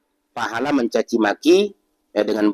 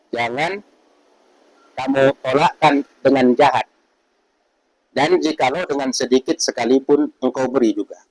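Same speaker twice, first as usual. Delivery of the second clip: emphatic recital; 4 semitones higher, 4 dB louder than usual. Shown as a demonstration. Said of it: "Nah, jadi bilang gini".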